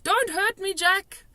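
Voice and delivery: high, loud begging cry; pleading yell. A narrator's reading. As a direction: beg him not to hurt you. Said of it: "he yelled".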